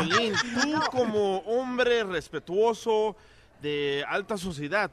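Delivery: slow, laughing mock sermon to Piolín; mock solemn teasing. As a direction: drag it out like a mocking lecture. Host teased mockingly, laughing.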